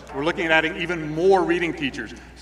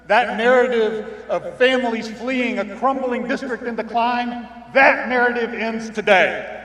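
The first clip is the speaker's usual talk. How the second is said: rousing speech; 3 semitones higher than usual. Acted. Rallying speech climax.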